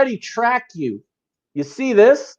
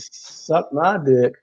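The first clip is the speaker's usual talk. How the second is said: slow deadpan retort; 7 semitones lower, much slower than usual. Real, not acted. Retort to teasing.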